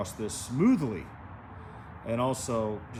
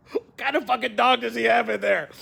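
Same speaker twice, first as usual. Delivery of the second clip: loud, high hammed-up disbelief; mock alarmed onlooker; 10 semitones higher, 7 dB louder than usual. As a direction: act out a stunned passerby, raised voice. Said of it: "People are gonna be like".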